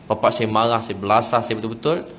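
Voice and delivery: ordinary talk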